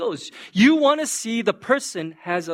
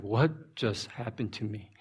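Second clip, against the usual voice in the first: stunned, fazed; 8 semitones lower, 4 dB quieter than usual. Acted. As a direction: voice a wronged man's dazed, hushed thoughts. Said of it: "just kinda stunned. He's kinda fazed".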